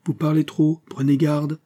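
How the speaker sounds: high, uneasy warning; unsteady voice. A narrator's reading. Aramis warning the raving Fouquet, uneasily. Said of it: "d'une voix mal assurée".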